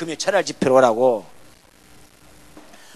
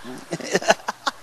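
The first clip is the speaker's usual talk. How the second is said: laughing. Real, not acted. Laughs at own joke.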